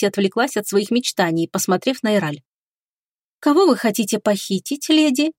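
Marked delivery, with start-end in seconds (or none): 3.4-5.3 curious. Acted. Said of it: "с любопытством спросила".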